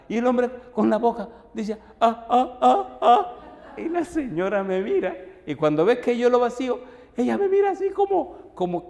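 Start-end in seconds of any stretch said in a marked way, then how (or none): 2.0-3.4 mock gasps. Man gasping as coffee dumped.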